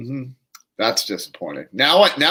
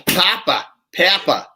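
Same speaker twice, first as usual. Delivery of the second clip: mock Chinese accent; 6 semitones higher, slower than usual. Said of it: "trying to do a Chinese accent".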